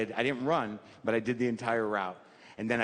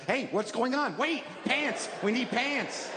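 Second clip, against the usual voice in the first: much higher, chirpy voice for the shoes; eager talking shoes; 11 semitones higher, slower than usual. Pretend. Shoes nagging him to get dressed, chirpy high voice. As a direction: play the shoes as excited little helpers.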